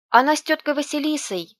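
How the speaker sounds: high, loud, eager outburst; excited little boy. A narrator's reading. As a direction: pipe up like a child jumping on the spot. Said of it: "на месте подпрыгнувший".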